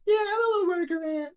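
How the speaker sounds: very high, squeaky worker ant; high-pitched little ant voice; tiniest little voice. Acted. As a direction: pipe up as a tiny ant agreeing. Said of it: "the tiniest little voice go".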